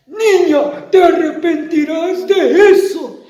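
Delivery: amenaza aguda y fuerte; monstruo amenazante. Acted. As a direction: amenaza furioso a la niña. (monstruo amenaza a la niña)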